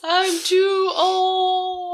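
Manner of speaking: high, very drawn-out lament; hammed-up despair, slow; mock anguished wail. Acting out Pop's collapse, mocking.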